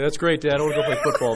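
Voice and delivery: put-on high, loud jock kid voice; breezy dismissive teenage son, laughing; sporty teen son.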